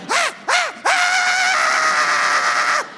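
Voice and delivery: very high escalating screams; kid screaming. Retold or imitated. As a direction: shriek like a panicked child. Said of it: "He's like".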